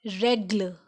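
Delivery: "règle" as REG-luh; French pronunciation. Shown as a demonstration. Teacher showing learners the accent grave, "règle" said REG-luh. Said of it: "It sound like".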